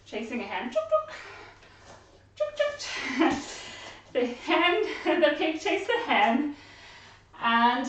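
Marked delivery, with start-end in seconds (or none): 0.7-3.3 very high clucking; hen clucks. Acted. Hen being chased.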